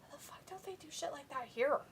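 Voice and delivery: a hushed envious aside; whispering. Real, not acted. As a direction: whisper it under your breath. Envious aside about the food.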